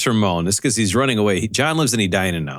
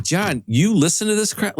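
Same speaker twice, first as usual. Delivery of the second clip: slow, higher mock outrage; teasing disbelief; 7 semitones higher than usual. Calling John on the phone, teasing him with slow, higher mock outrage. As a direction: rib him, drawn out and incredulous.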